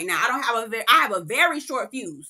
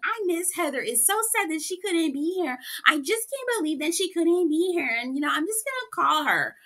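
mock sad; 6 semitones higher than usual. Mocking Lisa's fake sadness.

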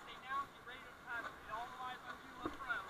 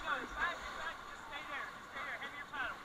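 raised voice, urgent; loud urgent calls; 4 semitones higher, 6 dB louder than usual. Real, not acted. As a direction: call it out firmly. Calming after a swimmer.

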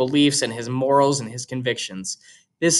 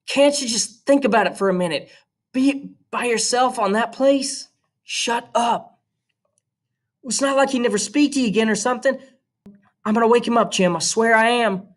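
put-on higher young girl, protesting; Scout's voice, higher and urgent; girl's voice; 9 semitones higher than usual. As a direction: play the little sister pushing back. Scout begs then threatens.

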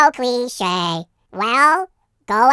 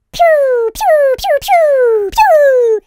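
laser sound effect; 12 semitones higher, 5 dB louder, much slower than usual. Acted.

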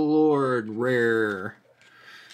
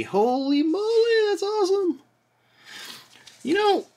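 excited. Real, not acted. Excited pull reaction.

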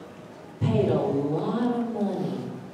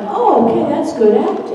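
impressed; 9 semitones higher, 12 dB louder than usual. Retold or imitated. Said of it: "you'll get to some other characters like".

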